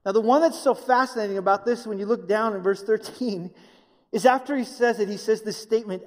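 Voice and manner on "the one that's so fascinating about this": ordinary talk, nothing marked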